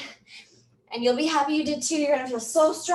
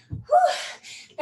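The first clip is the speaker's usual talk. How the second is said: high breathy whew of relief; exhale whew. Real, not acted. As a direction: blow out after the effort. Exhale after the set.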